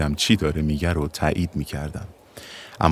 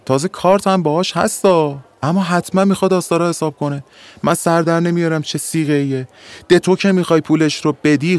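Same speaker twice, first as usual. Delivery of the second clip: loud, high, baffled complaining; exasperated Afshar; 9 semitones higher, 5 dB louder than usual. Acted. Afshar to narrator, loud exasperated complaining. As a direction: vent your frustration, voice raised. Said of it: "افشار گفت".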